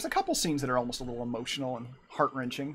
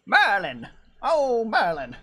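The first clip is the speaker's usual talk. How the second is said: loud, higher cry of "Merlin"; old wizard impression; 7 semitones higher, 5 dB louder, slower than usual. Streamer imitating the Sword in the Stone wizard, loud and high. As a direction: call his name like a flustered old wizard. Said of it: "I'm trying to imitate him from Sword in the Stone".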